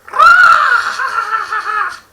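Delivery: shrill, very loud monster shriek; horrible screech. Acted. The Enderman screeches at Kenneth, horribly loud. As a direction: let out a piercing creature screech. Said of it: "let out a horrible screech".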